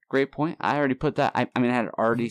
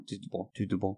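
French-accented Portuguese; 2 semitones lower, 7 dB quieter than usual. Shown as a demonstration. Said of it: "has a French accent when he says it".

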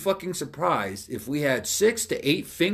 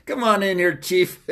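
raised, higher mock summons; calling someone in; 5 semitones higher, 5 dB louder than usual. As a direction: call the culprit in like an amused boss. Calls the culprit into office.

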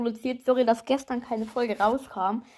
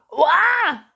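scream; 6 semitones higher, 7 dB louder than usual. Pretend. Sudden scream during filter test.

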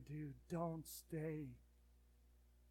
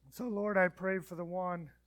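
praying; 4 dB louder than usual. Acted. Leads a prayer.

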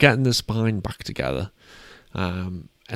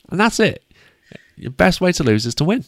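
emphatic slogan; 10 semitones higher, 5 dB louder than usual. Real, not acted. Repeating coach's slogan emphatically.

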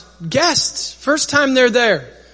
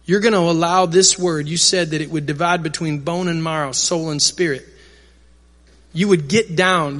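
earnest, lower-pitched prayer to God; leading prayer; 4 semitones lower than usual. Real, not acted. Leads the room in prayer.

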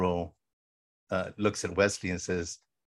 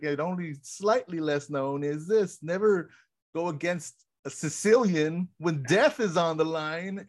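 slow, higher, theatrical villain proclamation; mock Vizzini; 7 semitones higher, slower than usual. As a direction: build to a grand NEVER, slow and high. Vizzini quote retold.

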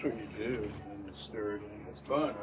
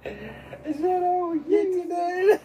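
high, slow laughing question; 8 dB louder, much slower than usual. Real, not acted. Joking about what's for dinner, laughing hard. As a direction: squeal it out through laughter.

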